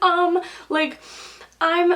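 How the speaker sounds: mildly anxious, halting retold reply; retold nervousness. Retold shaky reply to nurse.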